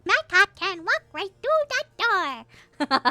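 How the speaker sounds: very high, squeaky silliness; high-pitched goofy voice; silly high voice. Pretend. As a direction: chirp it way up high. Silly high voice answering about accents.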